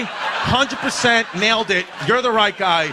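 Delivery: hammered home at his dad; laughing half-shout at the father; mock shout. Telling off criminal father.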